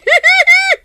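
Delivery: a squealing high laugh; high giggling laugh. Acted. Captain giggling about the pretty sales lady, high and loud. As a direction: giggle like a smitten old man.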